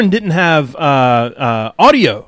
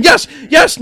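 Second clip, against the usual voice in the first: excited yes; 12 semitones higher than usual. Retold or imitated. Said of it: "I'm just still typing".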